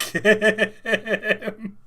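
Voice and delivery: high laugh breaking through; real burst of laughter. Real, not acted. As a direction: crack up mid-word. Laughing at [name] claim.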